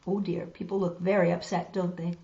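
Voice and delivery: usual speaking voice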